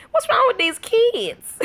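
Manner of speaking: high, drawn-out mock bafflement; comic exasperation. Real, not acted. Mom complains about the family's kids, exasperated. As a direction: wail it slowly in comic disbelief.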